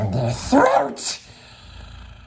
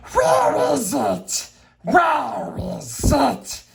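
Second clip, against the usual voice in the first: much higher, slow, frantic wail; Gollum screaming; slower than usual. Acted. Gollum searches his island for the ring, screaming high. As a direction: shriek it like a creature who lost everything. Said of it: "Bilbo heard him screaming".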